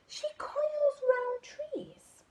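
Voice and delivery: high, slow rising surprise; butterfly amazed at the clue; surprised question. Acted. Butterfly surprised the monkey's mum coils round trees, high and slow.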